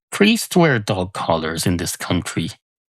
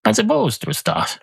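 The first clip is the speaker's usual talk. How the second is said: high musing; 12 semitones higher than usual. Real, not acted. Muses aloud, then repeats line.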